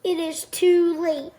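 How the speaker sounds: ordinary delivery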